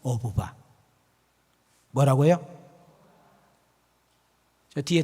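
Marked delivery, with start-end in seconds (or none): 0.0-0.5 low, softened coaxing; lightly imitated soothing mother; mother to crying child. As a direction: gently offer a piggyback to a crying child. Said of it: "그 울고 있는 아이를 향해서 뭐라고 한지 아세요?".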